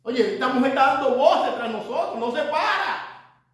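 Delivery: annoyed disciples begging, drawing out the last words; urgent complaint. Retold or imitated. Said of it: "Fue los discípulos que dijeron".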